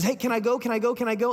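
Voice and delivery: high, insistent pestering; Ahimaaz. Retold or imitated. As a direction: nag eagerly in a rapid string. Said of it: "Ahimaaz bothers Joab again".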